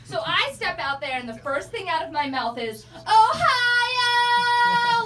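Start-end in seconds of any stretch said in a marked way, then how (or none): 2.9-5.0 high, loud bachelorette-party whoop; sing-song drunk party cheer; party shout. Retold or imitated. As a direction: belt it like a party girl. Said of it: "Like I'm at a fucking bachelorette party".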